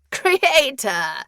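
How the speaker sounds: loud, high madman's exclamation; laughing scorn. A narrator's reading. Prisoner mocks the word creator, laughing scorn. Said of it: "He laughed".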